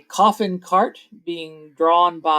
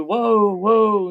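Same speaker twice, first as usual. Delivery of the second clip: raised driver's whoa; calling a halt; 5 semitones higher, 4 dB louder than usual. Acted. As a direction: rein in the team with a high, loud call. Peddler halts his wolf cart.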